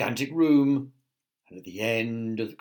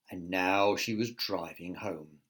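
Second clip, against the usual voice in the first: usual speaking voice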